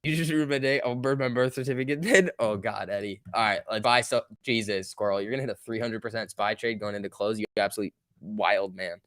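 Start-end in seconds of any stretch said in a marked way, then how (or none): none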